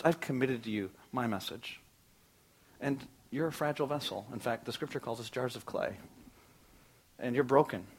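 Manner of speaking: quiet, earnest, slowing on key lines; Jesus to followers. Acted. Jesus sending his followers out, quiet and earnest. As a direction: hand over the mission gently and seriously. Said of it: "He says to his followers".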